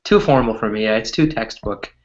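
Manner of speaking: normal talking voice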